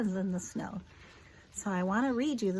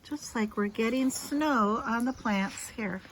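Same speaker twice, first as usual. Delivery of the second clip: usual speaking voice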